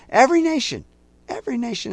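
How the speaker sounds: high loud cry on every nation; preaching cry. Real, not acted. Preacher crying out to his congregation, high and loud. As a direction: cry it out to the whole room.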